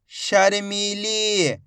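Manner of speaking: शर्मीली very slow, मी and ली held; teacher drawing out शर्मीली; blended slow reading. Shown as a demonstration. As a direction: join शर्मीली slowly, stretching each ee. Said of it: "एक एक अक्षर का उच्चारण करके".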